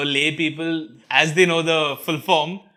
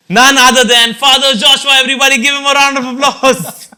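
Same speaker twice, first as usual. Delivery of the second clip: loud, high announcer shout; hyped guest introduction; 9 semitones higher, 9 dB louder than usual. Real, not acted. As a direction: belt out the big welcome, pitched high and building applause. Host announcing guest to audience.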